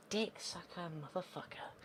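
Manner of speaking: high, very soft swearing; muttered cursing. Real, not acted. Player hearing screeching next door, hushed cursing. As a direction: curse under your breath, high and hushed.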